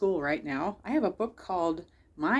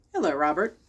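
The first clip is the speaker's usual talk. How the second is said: lower, stern teacher greeting; teacher answering the boy, lower; 4 semitones lower than usual. Acted. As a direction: drop into the teacher's lower register.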